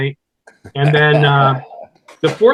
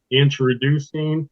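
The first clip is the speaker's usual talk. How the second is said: infomercial voice; 3 semitones lower than usual. Retold or imitated. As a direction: announce it like a TV infomercial. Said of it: "I do a bit on the shake weight".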